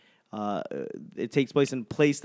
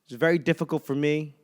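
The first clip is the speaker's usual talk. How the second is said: ordinary talk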